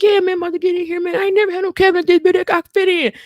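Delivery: high, loud, fast excited voice; goofy excitement. Retold or imitated. Husband excited about fitting in a cabinet, high loud glee. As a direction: gush like a kid who found a fort. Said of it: "He like".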